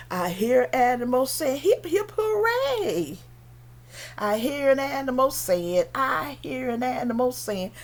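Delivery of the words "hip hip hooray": high-pitched hip-hip, drawn-out falling hooray; cheering hippo. Acted. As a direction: cheer as a jolly cartoon animal.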